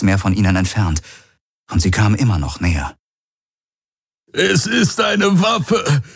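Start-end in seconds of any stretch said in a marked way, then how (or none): 4.3-6.0 hoch, sehr langsam, gequält; gequält gekeucht. A narrator's reading. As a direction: unter Schmerzen keuchen. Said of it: "keuchte Hawks gequält". (gequältes Keuchen)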